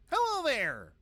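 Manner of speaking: a quick hint of a cartoon scientist voice; light cartoon character impression; Particle Pete impression. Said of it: "Particle Pete".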